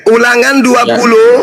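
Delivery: high, forceful call over interruptions; insistent announcement. Real, not acted. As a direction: call out the reference to claim the floor.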